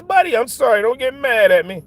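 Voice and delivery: ordinary talk, nothing marked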